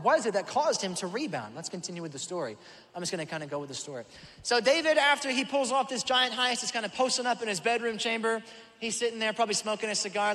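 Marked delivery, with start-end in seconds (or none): 4.4-10.3 high, loud storytelling burst; preaching. Acted. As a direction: kick the story off with full preaching energy. Launching into the story.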